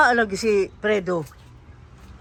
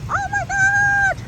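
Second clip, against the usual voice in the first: startled shriek; 4 dB quieter, much slower than usual. Real, not acted. Caught in sudden rain.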